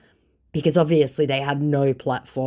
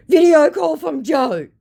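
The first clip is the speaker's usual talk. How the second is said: higher and louder, dangling an offer; mock teasing scammer; 8 dB louder than usual. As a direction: tempt like a scammer, overplayed. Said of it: "trying to dangle a carrot".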